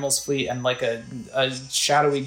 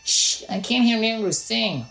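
put-on higher, slow hushing kid; little boy voice; 8 semitones higher than usual. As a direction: shush like an annoyed little boy. Little boy character line.

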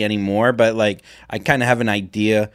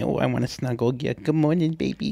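soft, high cutesy voice-note coo; cooing baby talk; 4 semitones higher, 8 dB quieter than usual. Retold or imitated. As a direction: coo it like a sleepy sweet nothing. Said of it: "where I just say".

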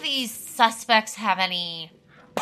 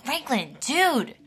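put-on exasperated kid; 7 semitones higher, slower than usual. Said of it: "like, she, like".

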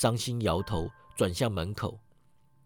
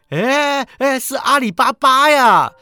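high, loud shout of delight; happy cry; 12 semitones higher, 10 dB louder, slower than usual. A narrator's reading. Scrooge spots Ali Baba at the window, happy cry. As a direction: cry out in joyful recognition. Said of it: "史古基开心地喊道".

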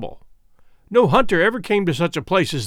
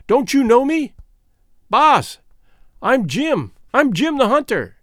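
high, raised, eager calling; excited hallooing; 6 semitones higher, 4 dB louder than usual. A narrator's reading. Jim greets his old master after two years, excited hallooing. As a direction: call out eagerly to be recognised. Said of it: "he hallooed".

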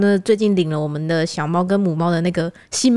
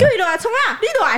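higher mock-gruff uncle summons; 13 semitones higher than usual. The uncle summons the driver, higher mock gruff. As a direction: call her over curtly. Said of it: "全程讲台语哦".

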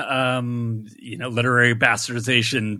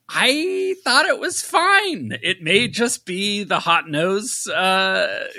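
much higher, slow squeaky nervous answer; mock nervous witness; 9 semitones higher, much slower than usual. Grilled by the accent cop, a high, slow nervous witness squeak. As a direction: play the sweating suspect under the lamp.

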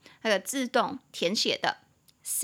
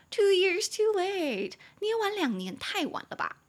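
mock exasperation; 10 semitones higher than usual. Teacher teasing Apple for being late, mock exasperation. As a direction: whine it in an exaggerated high pitch. Mock complaint about lateness.

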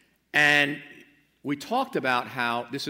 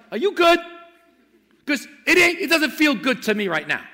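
anguished cry; 13 semitones higher, 10 dB louder than usual. Acted. Crying out to God.

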